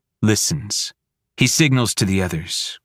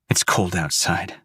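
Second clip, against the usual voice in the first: ordinary delivery, nothing marked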